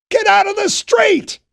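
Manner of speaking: very high, loud furious bellow; angry shout. A narrator's reading. Captain Fisher screams at Knopf to get off the street, furious. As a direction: scream the order as he charges in. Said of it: "He looked angry".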